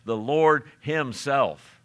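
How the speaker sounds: very slow, Lord stressed with a falling tune; slow emphasis. Shown as a demonstration. Preacher pressing the point on his listeners, slow with Lord stressed. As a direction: drag it out, weighting Lord. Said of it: "that's an emphasis we should not pass over".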